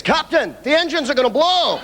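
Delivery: put-on crap Scottish accent, very high-pitched; hammed-up, high Scottish-accented alarm; Scotty. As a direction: yelp it in a bad Scottish accent. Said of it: "everybody can do a crap Scottish accent".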